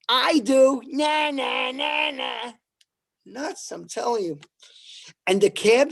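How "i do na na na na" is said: higher, shouted na-na taunt; crazy subway rider taunting the comedian; taunting singsong. Retold or imitated. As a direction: tease like a lunatic kid.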